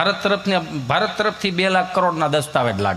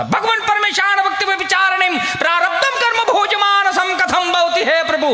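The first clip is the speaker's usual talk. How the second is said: mock katha preacher; 12 semitones higher than usual. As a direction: ham up a pompous storyteller's chant. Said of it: "ન્યા બોલતા હતા". (કથાકારની જેમ ઊંચા સૂરે ધીમું લલકારવું)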